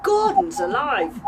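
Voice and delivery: loud, slow cry of alarm; shocked outburst. Acted. Priya startled as her shackle snaps, loud shocked cry. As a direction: blurt it out in shock.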